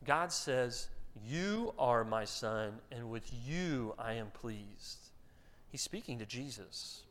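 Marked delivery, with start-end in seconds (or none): 1.2-5.1 tender to son. Shown as a demonstration. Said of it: "That's not what God says".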